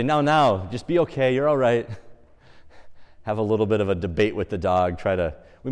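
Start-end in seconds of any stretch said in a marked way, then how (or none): 0.0-1.9 louder, higher coaxing of a dog; mock placating. Walker reasoning with the chained dog, mock placating. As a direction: try to talk down a barking dog.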